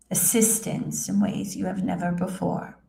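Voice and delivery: normal talking voice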